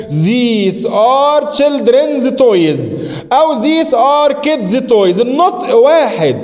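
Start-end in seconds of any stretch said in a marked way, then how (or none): 0.1-5.2 very slow, drawn-out model sentences. Shown as a demonstration. Teacher modelling both English examples slowly. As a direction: read both examples word by word. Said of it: "أقولها إزاي؟".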